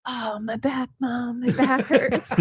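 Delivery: high little-kid whine of fake pain; Charlie. Mother imitating son during phone call, whiny mock pain. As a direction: imitate a child playing at backache. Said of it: "He was mocking you?".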